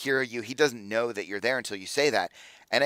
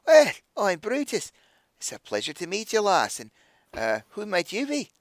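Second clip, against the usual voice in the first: higher-pitched, lilting dwarf voice; dwarf gentleman greeting a lady, pitched up; courtly dwarf; 9 semitones higher than usual. Acted. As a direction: introduce yourself gallantly as a dwarf. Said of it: "little dwarven elbow".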